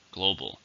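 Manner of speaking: "Global" with the stress on its first syllable. Shown as a demonstration. Teacher answering the syllable question, "Global" stressed on the first syllable. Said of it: "Stresses on the first".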